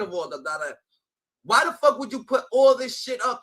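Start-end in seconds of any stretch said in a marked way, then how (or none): 1.4-3.4 angry outburst. Real, not acted. S1 ranting angrily at the man.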